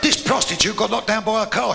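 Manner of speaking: normal talking voice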